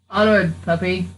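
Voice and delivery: ordinary talk, nothing marked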